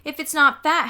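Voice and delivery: loud high taunting comeback; mocking retort. A narrator's reading. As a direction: throw it back mockingly. Sheila mocks back.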